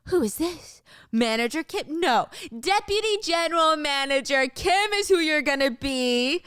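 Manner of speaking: smug boss, lifted pitch; Wang voice. Acted.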